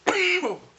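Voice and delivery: harsh hacking cough; coughing fit. Real, not acted. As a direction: cough hard, out of control. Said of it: "sorry if I'm coughing".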